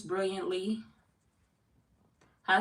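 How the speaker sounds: ordinary talk, nothing marked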